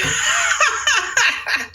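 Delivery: a high burst of laughter. Real, not acted. Laughing at her own punchline, high bursting laugh. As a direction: crack up at the punchline.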